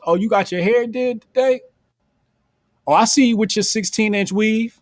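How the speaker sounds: high, playful teasing; teasing noticing. Retold or imitated. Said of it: "show you I noticed".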